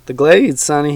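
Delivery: a hint of a folksy old swamp dweller; teasing old man, lightly voiced.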